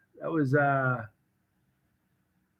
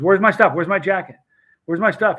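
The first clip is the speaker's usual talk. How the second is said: louder, urgent repeated demand; mock-frantic, voice raised; acted panic; 4 semitones higher, 7 dB louder than usual. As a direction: imitate a rattled man hunting for his things. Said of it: "like he was scared".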